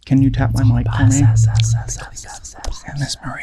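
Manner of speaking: breathy ASMR whisper into the mic. Pretend. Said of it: "We'll all do A S M R".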